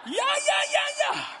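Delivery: high, loud brushing-off ja ja; mimicking the doctor who won't listen, brushing it off; mock dismissive yes-yes. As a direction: wave it away in a mocking squeal.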